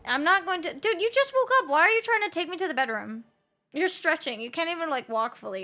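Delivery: loud, high, fast disbelief at the cat; half-laughing outburst; excited high rant. Real, not acted. Streamer yelping at her stretching cat, loud and high.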